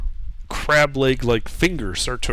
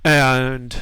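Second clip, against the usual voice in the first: usual speaking voice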